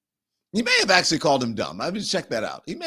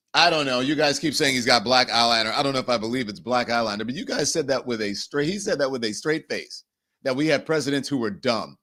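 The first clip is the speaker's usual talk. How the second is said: normal talking voice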